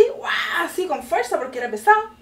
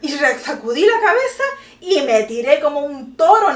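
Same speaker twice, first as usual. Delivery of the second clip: normal talking voice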